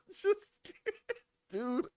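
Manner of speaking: high, squeaky tearful laughing; cracking up in disbelief; helpless laughter. Real, not acted. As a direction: barely get the words out through laughter. Helpless laughter after interception.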